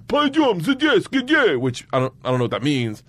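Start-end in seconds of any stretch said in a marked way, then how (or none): 0.1-1.6 high-pitched barked Russian; mock a cop's sudden command, pitched up; retold bark. Cop calling student over in Russian, barking. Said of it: "I hear him bark out".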